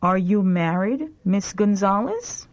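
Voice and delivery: ordinary talk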